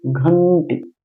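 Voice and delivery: slow घंटी with a long nasal n; teacher's slow model of घंटी, n held in the nose; nasalised pronunciation. Shown as a demonstration. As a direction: stretch the nasal n in घंटी before टी. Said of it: "नाक बोलना ना".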